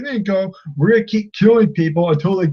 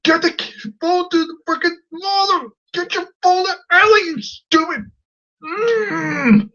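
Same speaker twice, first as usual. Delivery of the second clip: high-pitched exasperated outburst; worked-up, squealing frustration; frustrated; 12 semitones higher than usual. Acted. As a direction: rant at the kid in a strained high voice. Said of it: "it frustrated me".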